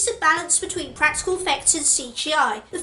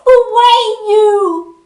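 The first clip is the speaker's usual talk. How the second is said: mock wailing; 6 semitones higher, 7 dB louder, slower than usual. Mock grief for dead character.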